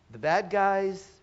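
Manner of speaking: normal talking voice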